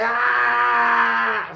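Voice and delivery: loud, high, long-held yell; hollering. Retold or imitated. Inmate disrupting guard's questioning of victim, hollering. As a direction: holler to drown someone out. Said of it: "Start hollering".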